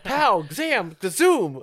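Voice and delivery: high, loud cartoon action shout; comic book sound effects. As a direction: belt it like a comic strip.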